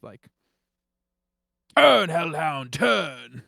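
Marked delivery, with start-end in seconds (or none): 1.8-3.4 booming theatrical command; dramatic shout. Acted. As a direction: call it out like a stage hero. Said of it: "I just chime in with".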